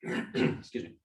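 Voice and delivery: throat irritated, coughing; talking through a tickle in the throat; just swallowed a bug. Real, not acted. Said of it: "Swallowed a bug".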